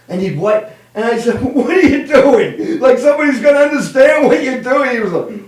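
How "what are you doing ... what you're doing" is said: high, exclaimed retort with a laugh; mock incredulous. Nephew watching uncle wipe away sand pictures, high mock incredulity. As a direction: challenge him in amused disbelief.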